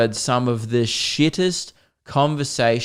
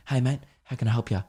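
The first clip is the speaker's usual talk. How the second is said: normal, discreet volume; 9 dB quieter, faster than usual. Retold or imitated. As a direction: imitate staff keeping it down. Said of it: "speaking in a normal volume".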